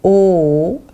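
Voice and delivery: O drawn out, rising at the end; long O. Shown as a demonstration. Teacher sums up how O is pronounced, long O. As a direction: hold O as one long vowel. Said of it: "qui va se prononcer".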